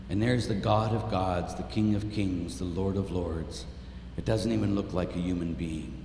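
low, soft, solemn preaching; grave and quiet, dwelling on the sight; hushed reverence. Real, not acted. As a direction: drop to a hushed, awed voice. Hushed sorrow at beaten Jesus.

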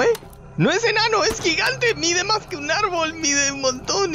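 grito agudo de alarma ante el gigante; alarmado. Real, not acted. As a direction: exclaim high and frantic, easing slightly by the end. Spots a giant, alarmed. (exclamación alarmada, muy aguda)

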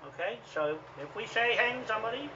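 loud angry rant; angry outburst. Real, not acted. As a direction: throw it out like a furious challenge. Ranting threats at a lawyer.

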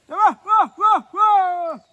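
loud lament. Real, not acted. Dogs have left him.

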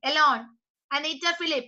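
normal talking voice

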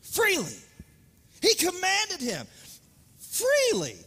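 "freely" called out high and loud; emphatic freely. Shown as a demonstration. Preacher leading congregation in the word, "freely" high and forceful. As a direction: ring out "freely" for everyone to echo. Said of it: "Everybody say the word freely".

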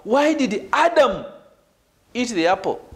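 single out "Adam" against anyone else; stress on Adam. Shown as a demonstration. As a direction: land hard on "Adam", then let it drop. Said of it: "When I put emphasis on Adam".

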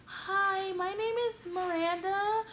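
timid self-introduction, drawn out; shy intro. Retold or imitated. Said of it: "being all shy".

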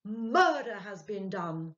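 alarmed loud cry; urgent cry. A narrator's reading. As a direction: press it on him in horror. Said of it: "cried Ludwig".

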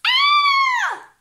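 very high, loud frightened shriek; terrified scream. Acted. Little toad screaming in fright at the monster. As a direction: let out a piercing shriek. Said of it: "Sapito dio un alarido".

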